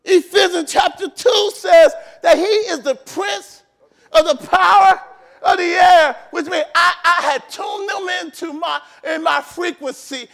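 loud, fervent pulpit preaching; preaching at full force. Acted. Pastor rousing the church about authority, full-force preaching. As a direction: drive it home to the congregation.

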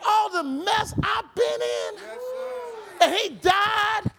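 the preacher whips the congregation into a shouted, chanting peak; preaching at full force. Acted.

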